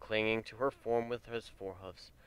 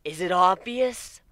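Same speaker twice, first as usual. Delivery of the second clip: higher, louder, slow biting sarcasm; bitter sarcasm; 9 semitones higher, 10 dB louder, slower than usual. A narrator's reading. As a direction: spit it back slowly, raised. Bitter retort in a fight.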